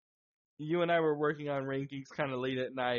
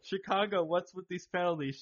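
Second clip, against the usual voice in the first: normal talking voice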